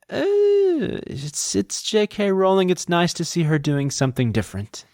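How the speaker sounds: high, slow, patronising gush; mock reviewer voice. Podcaster mimics book reviewers of Rowling's novel, mock gushing. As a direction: sound like a politely unimpressed critic. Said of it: "reviews that I read about it are kind of like".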